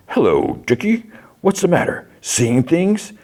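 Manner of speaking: high-pitched, sing-song creepy clown voice; taunting clown. Retold or imitated. Pennywise standing before Dick in the flames, high taunting clown voice. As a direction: tease him in a squeaky clown voice.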